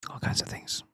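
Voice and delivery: whispered aside. Real, not acted. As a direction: drop to a whisper. Trails off in a whisper.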